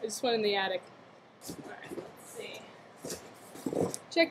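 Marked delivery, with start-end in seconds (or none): 1.5-2.6 breathless secret whisper, no voice. Real, not acted. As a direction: whisper it as if sneaking around. Sneaking into the attic quietly.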